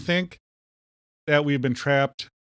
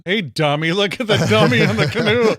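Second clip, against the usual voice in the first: high, hammed-up heckle; mock jeering; 7 semitones higher, 6 dB louder than usual. Kayaker heckling a passing canoer, mock jeering call. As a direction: call out a playful taunt, pitched up and loud.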